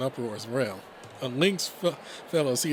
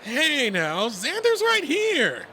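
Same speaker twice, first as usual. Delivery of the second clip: high, loud, drawn-out cry; loud squawk of protest; mock-offended protest; 14 semitones higher, 6 dB louder, much slower than usual. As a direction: call out in playful objection. Redline protests friend's jab, laughing.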